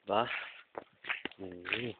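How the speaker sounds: normal talking voice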